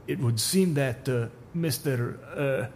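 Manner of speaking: put-on fumbling Russian accent; Russian accent, stalling for a name; hesitant Russian accent. Supervisor fishes for the stranger's name in a hesitant Russian accent. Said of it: "Russian accent".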